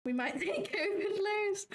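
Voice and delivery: high, slow words through laughter; helpless giggle pushing the pitch up; laughing. Real, not acted. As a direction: crack up while saying it. Laughing at a wild market prediction.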